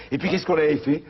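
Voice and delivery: ordinary talk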